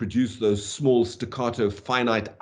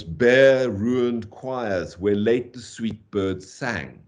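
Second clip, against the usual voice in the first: slow, drawn-out weight on "Bare ruined choirs"; stately line with long stresses on "Bare ruined choirs"; three opening stresses; much slower than usual. Shown as a demonstration. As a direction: lean on each word of "Bare ruined choirs", then on "birds sang". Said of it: "begins with three stressed syllables".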